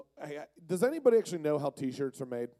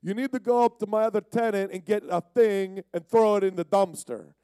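Italian boss; 5 semitones higher, 4 dB louder than usual. Retold or imitated. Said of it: "He's like".

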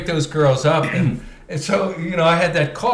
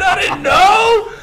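high, drawn-out whine of innocence; mock-helpless protest; 14 semitones higher, 4 dB louder, much slower than usual. Boat crasher protesting to the angry woman, mock-helpless. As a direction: stretch it upward, pleading ignorance.

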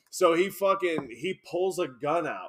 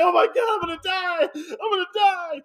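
high-pitched wail of terror, imitated; mock crying panic; 12 semitones higher, 4 dB louder than usual. Retelling the man trapped in the trunk, mock crying panic. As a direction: wail it like the terrified victim. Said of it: "He's, like, crying".

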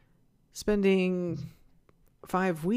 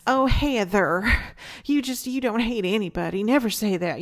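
louder, higher imitation of a Midwestern grandmother; 4 semitones higher, 7 dB louder than usual. Grandmother correcting her granddaughter, imitated louder in a Midwestern accent. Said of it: "she had a Midwestern accent, but she would say".

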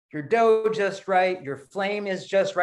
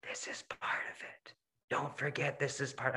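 soft, unvoiced whisper in the ear; 2 semitones lower, 12 dB quieter than usual. Inner voice reassuring him through a bad year, whispered. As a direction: breathe it like an inner voice. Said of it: "a little voice that kept whispering in my ear".

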